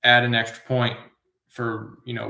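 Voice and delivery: ordinary delivery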